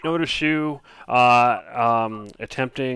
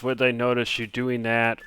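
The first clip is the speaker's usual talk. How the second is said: ordinary talk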